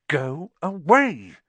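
irritated dismissal. A narrator's reading. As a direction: drag it out to send her off. Boy dismisses the nagging girl.

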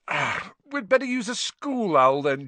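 high yawn running into words. A narrator's reading. Fred suggesting a school owl, yawning. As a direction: talk through a yawn.

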